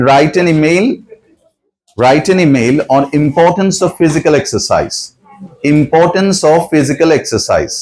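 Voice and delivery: very slow, careful classroom reading; slow dictation. Shown as a demonstration. Teacher reading the worksheet instruction to students, slowly. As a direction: spell it out slowly for the pupils.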